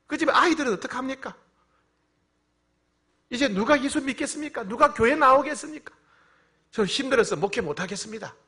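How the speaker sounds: high, insistent retold complaint; despairing protest. Pastor tells God he cannot go on, high despairing protest. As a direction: fire desperate questions at God, pitched high.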